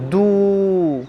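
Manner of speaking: दू held long on a pure "u"; sustained, level दू; correct stretched vowel. Shown as a demonstration. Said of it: "क्या पढ़ेंगे".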